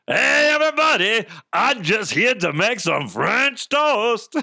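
high, hollered silly character voice; derailed Arnold voice. Acted. Botched Arnold greeting everybody to cook, high goofy holler. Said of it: "from Arnold Schwarzenegger to that fucking voice".

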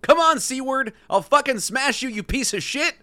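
high, heated threat; acted angry threat. A narrator's reading. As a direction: read it as a fired-up warning. Acted threat in golf quarrel.

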